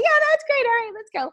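high, breezy mock-dad approval; typical dad. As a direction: imitate dad brushing past big news. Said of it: "just a typical, like, dad thinking back".

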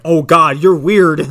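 loud, mock-disgusted oh God; mock recoil. Host voicing the duck recoiling from her, loud and mock-disgusted. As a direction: blurt it out, hammed-up recoil. Howard reacting to eager woman.

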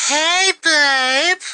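high, sugary mock greeting; mock girlfriend voice; mock sweet girl. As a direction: imitate her waking him up sweetly. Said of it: "you know what happens?".

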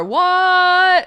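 high, loud mock-shocked "what"; sudden jokey gasp of surprise. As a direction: blurt it like you can't believe it.

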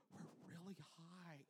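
put-on soft, slow voice of a nervous kid; hushed, drawn-out dread; small scared voice. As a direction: imitate bravado shrinking to a tiny voice. Scared kid at the coaster's top.

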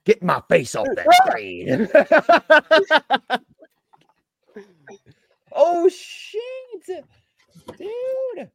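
high, squealing laughter; laughing. Real, not acted. Laughing at own face shown.

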